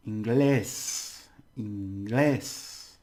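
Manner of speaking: "inglés" with a loud, drawn-out "glés"; stress on final syllable. Shown as a demonstration. Teacher saying English in Spanish first, stress on final syllable. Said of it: "Actually, the stress".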